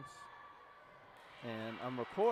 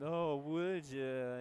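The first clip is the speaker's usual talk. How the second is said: drawn-out whiny plea; mock begging; much slower than usual. Coach mocking reps who beg prospects, slow whiny plea. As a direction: stretch it out like begging. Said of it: "Begging and".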